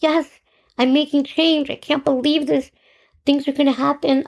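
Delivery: high, eager rush of joy; thrilled you're finally changing things; excited. Retold or imitated. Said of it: "I was excited".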